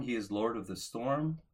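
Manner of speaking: ordinary talk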